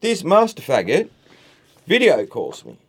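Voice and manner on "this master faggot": ordinary delivery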